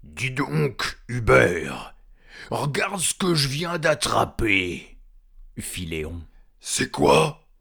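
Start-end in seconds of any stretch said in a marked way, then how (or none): 0.0-5.0 high, loud troll voice; slow, drawn-out troll call to a mate. Acted. Troll Léon showing his mates a catch, loud troll voice. 6.6-7.5 loud, high troll question; curious troll. Acted. Trolls asking Léon about his catch, loud curiosity.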